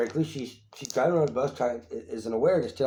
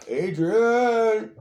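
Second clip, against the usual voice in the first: high, long drawn-out call; mock Rocky cry; 10 semitones higher, 6 dB louder than usual. Co-host calling Adrian as Rocky, hammed-up cry. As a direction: holler the name like a movie boxer.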